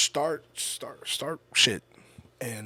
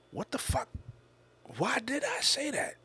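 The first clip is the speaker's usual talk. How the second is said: high-pitched replayed disbelief; acted-out inner disbelief, pitched way up; baffled self-questioning; 12 semitones higher, 4 dB quieter than usual. Acting out his baffled self-questioning.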